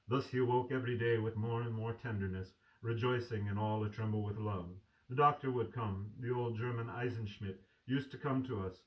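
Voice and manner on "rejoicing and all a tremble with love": ordinary delivery, nothing marked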